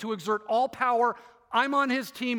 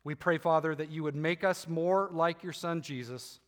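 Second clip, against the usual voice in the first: hushed, unhurried pastoral prayer voice; closing prayer; 4 semitones lower than usual. Acted. Pastor prays for the moms in the congregation, gentle and reverent.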